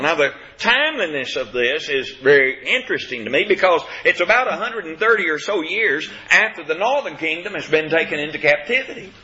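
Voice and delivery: usual speaking voice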